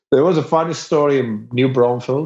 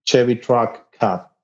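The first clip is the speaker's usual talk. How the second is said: usual speaking voice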